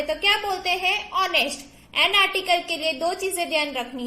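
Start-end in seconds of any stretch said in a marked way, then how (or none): 1.1-1.6 "ऑनेस्ट" bina h ke, o se shuru; "ऑनेस्ट" शुरू में एच के बिना; silent h. Shown as a demonstration. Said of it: "एच silent है". ("ऑनेस्ट" एच silent के साथ)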